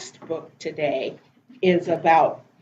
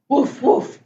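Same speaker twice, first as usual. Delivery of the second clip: short imitation woofs; quick, playful mock barking; puppy bark; 5 semitones higher, 4 dB louder than usual. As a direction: bark like a little dog. Story reader barks as puppy Biscuit.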